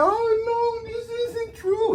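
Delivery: high, wailing imitation of fake sobbing; mock crying. Grandmother on TV denying the test result, mock crying. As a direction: ham up a teary denial, slowly at the end. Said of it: "she was up there crying".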